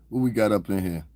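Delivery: usual speaking voice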